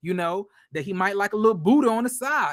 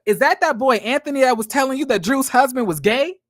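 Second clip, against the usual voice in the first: higher, louder gossipy friend; Sheree's friend butting in, higher and louder; nosy friend; 5 semitones higher, 5 dB louder than usual. Retold or imitated. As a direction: stir the pot as the friend. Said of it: "Sheree friend was like".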